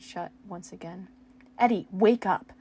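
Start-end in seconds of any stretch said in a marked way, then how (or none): 1.6-2.5 loud desperate shout. Acted. Steve shaking the unconscious Eddie, desperate shout. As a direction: yell at him to wake. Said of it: "Steve shouted".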